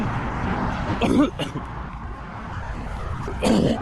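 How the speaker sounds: hacking cough from a swallowed fly; coughing fit. Real, not acted. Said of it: "I just swallowed a fly".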